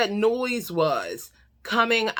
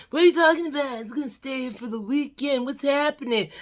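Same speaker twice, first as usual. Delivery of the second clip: high, put-on whiny protest; bewildered boyfriend; 7 semitones higher, faster than usual. Boyfriend told to leave the weekend early, bewildered protest. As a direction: plead in a higher mock man's voice. Plays confused man being sent away.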